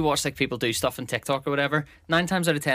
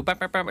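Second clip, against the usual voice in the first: higher-pitched mock horn toots; imitated horn; 5 semitones higher than usual. Celebrating followers without a horn, imitated horn. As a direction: toot like a party horn with the mouth. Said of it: "I don't have, like, a horn".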